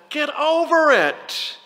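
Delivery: high, slow, brushing-off mock line; mock dismissive Jesus. Preacher jokingly voicing Jesus dismissing the mourners, mock dismissive. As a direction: toss it off like a careless joke. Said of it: "No, my translation doesn't say that either".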